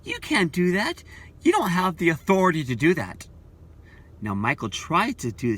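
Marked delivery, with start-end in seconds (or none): 0.0-3.3 high, louder outraged protest; mad protest. Retold or imitated. Member scolded for smoking, protesting to the elder in outrage. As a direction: sputter in disbelief, then push back. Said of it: "gets mad and says".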